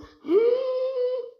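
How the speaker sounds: very high strained gasp for breath; dying gasp. Acted. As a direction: gasp as the last breath leaves. Avó moribunda ofegando. (arquejo muito agudo de quem morre)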